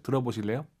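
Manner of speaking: ordinary talk, nothing marked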